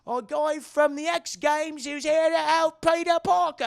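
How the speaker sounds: high, loud mock British woman; hammed-up British reporter, exclaiming; British accent. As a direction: put on a shrieky British TV-commentator voice. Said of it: "the British girl's like".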